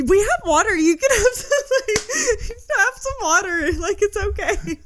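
talking to dry-mouthed partner, giggling; laughing through it. Retold or imitated. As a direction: coax him through laughter. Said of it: "I was like".

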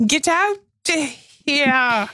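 high-pitched, badly faked Irish accent; attempted Irish accent. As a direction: try an Irish accent, half-committed. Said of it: "we should try to do Irish accents".

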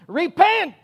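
high, loud hollered "Repent!"; street preacher yell. Retold or imitated. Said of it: "street preachers on soapboxes hollering and screaming".

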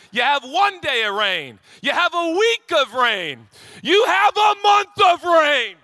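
building from loud to a high scream; escalating exasperation. Real, not acted. Parent enduring endless rain, rant building to shouting. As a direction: climb to a comic breaking point.

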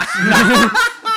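loud high burst of laughter; real roaring laugh. Real, not acted. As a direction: crack up at your own joke. Laughs at own joke.

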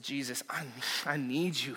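very soft, quiet plea; hushed prayer. Retold or imitated. Pastor silently asking Jesus for help, hushed. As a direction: pray it under your breath.

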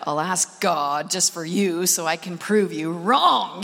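deep, slow, begrudging voice; grudging sarcasm. Retold or imitated. Preacher grudgingly agreeing to ask God, deep and sarcastic. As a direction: give in with heavy reluctant sarcasm. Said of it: "I said".

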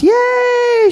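high loud cheer. A narrator's reading. Everybody clapping for the girl, loud high cheer. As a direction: cheer with the crowd.

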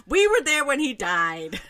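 hammed-up blurted introduction; mock announcement. Listener mocks Cassie's blunt introduction.

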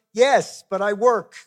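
mock indignant retort. Dad answering his teasing kids, mock indignant and loud. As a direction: fire back loudly in mock defence.

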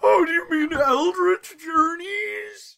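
high, slow mock question. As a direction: play the clueless fan in a silly high voice. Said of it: "mocking a confused person".

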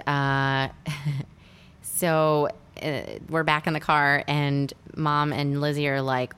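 normal talking voice

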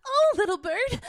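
high, loud, sweetly hammed-up princess. Shown as a demonstration. Aspiring voice actor auditioning as a princess to a bird, high and theatrical. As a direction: trill it like a cartoon princess. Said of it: "I wanna be a princess".

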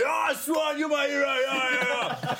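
very high, imitated adoring fan's yell; massive crowd roar. Player imitates crowd cheering his bite, high ecstatic roar. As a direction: roar adoration from the stands, pitched right up. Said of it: "got a massive roar".

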